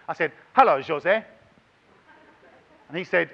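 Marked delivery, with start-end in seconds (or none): 0.5-1.2 louder, called-out hello; greeting called to a famous stranger; retold greeting. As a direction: hail him across the bar. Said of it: "went up to him and said".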